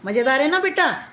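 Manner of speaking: ordinary delivery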